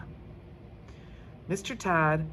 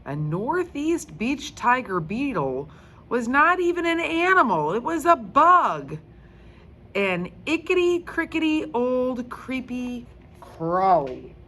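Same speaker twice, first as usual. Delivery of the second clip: loud, high, disgusted rant; 7 semitones higher, 6 dB louder than usual. A narrator's reading. Judy disgusted that her animal is only a bug, loud and high. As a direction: spit out the creepy bug words.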